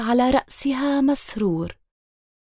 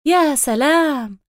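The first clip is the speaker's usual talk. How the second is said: usual speaking voice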